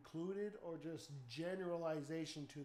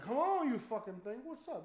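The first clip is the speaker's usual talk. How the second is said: loud, higher mock taunt; mock challenge; 7 semitones higher, 9 dB louder than usual. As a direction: square up to the creature. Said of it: "be like".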